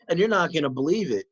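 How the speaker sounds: ordinary delivery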